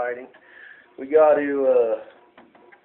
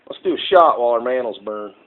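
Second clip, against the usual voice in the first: normal talking voice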